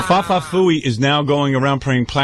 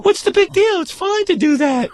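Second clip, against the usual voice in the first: much higher, slow mock Jackie voice. Howard imitating Jackie dismissing the fuss, high mock voice. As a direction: squeak it like a careless Jackie. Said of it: "he says".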